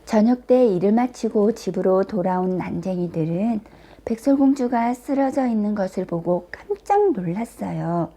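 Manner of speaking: usual speaking voice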